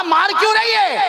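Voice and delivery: very high, startled question; astonished protest. Acted. Husband struck by his wife mid-praise, astonished. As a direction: cry out in bewilderment at an undeserved blow. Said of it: "حیران ہو گیا".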